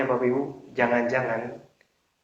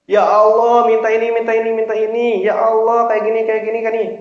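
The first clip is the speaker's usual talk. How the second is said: high, loud begging prayer; mock tearful pleading; 6 semitones higher, 4 dB louder than usual. Preacher imitates worshippers crying on the prayer mat, pleading. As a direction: imitate a wailing, insistent supplicant. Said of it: "di sejadah Bapak Ibu nangis-nangis".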